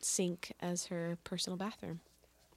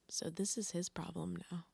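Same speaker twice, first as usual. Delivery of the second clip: whispering; 7 dB quieter than usual. Real, not acted. Whispered aside.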